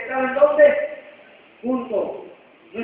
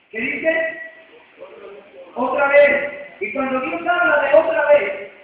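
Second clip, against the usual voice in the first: louder, rising fervent sermon voice; preaching; 3 semitones higher, 4 dB louder than usual. Acted. Pastor urging the congregation, fervent preaching.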